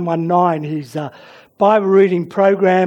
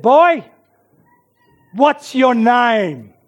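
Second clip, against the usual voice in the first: loud, raised, drawn-out demand; commanding general; 4 semitones higher, 4 dB louder, slower than usual. Acted. Alexander questions the young soldier before him, commanding. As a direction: ruler confronting a trembling soldier.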